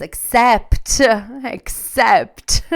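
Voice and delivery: laughing through the word; rueful laugh. Real, not acted. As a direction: giggle it out, caught out. Laughing at her own mistake.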